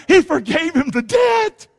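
loud call and response shout; shouted answer. Shown as a demonstration. Preacher leads the congregation, shouting. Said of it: "One more time".

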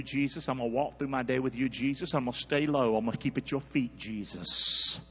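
low, soft humble prayer. Shown as a demonstration. Preacher modelling a humble prayer for the congregation, low and soft. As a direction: pray it quietly, head bowed.

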